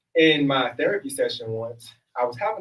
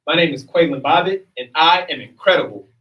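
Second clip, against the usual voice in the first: louder affirmation; 4 semitones higher, 7 dB louder than usual. Shown as a demonstration. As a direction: announce your name proudly, louder. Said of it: "we're gonna do a trial run".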